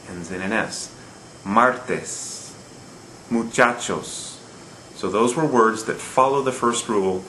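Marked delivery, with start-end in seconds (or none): 1.4-4.3 MARtes, muCHAchos; second-to-last syllable stress. Shown as a demonstration. Teacher giving Spanish examples, Martes and muchachos stressed on the next-to-last syllable. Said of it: "Notice the stress on the second to last syllable".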